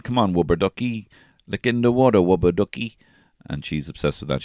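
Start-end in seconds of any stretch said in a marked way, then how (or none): 0.0-3.0 put-on wubber ducky with a w for the r, said higher like a small child; toddler saying wubber ducky. A boy in a rubber ring talking to his duck, wubber ducky with a w and a child's lisp. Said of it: "And I kept saying".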